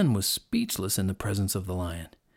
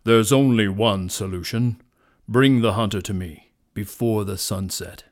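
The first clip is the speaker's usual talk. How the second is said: loud, commanding lion voice; booming lion; 3 semitones lower, 5 dB louder than usual. A narrator's reading. Lion orders the hunter brought to him, booming. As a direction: give the order with booming authority. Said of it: "the lion's voice boomed".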